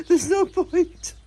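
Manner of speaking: slow, loud and high, breaking into a laugh; laughing emphasis. Real, not acted. Telling viewers about gel nails, laughing loud and slow. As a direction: laugh it out while stressing no.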